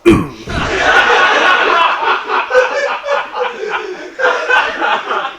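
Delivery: high, strained throaty grunt; a short pathetic strained noise; man's sad grunt. Retold or imitated. Comic imitating man climaxing with a throaty grunt. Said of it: "the other one comes like this".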